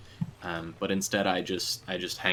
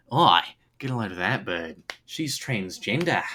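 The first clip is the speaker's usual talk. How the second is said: put-on Australian accent, louder, Oi punched; 3 dB louder than usual. Streamer showing her one Australian sentence, Australian accent. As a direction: ocker Australian bloke accent, a bit over the top. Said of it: "reliable Australian accent".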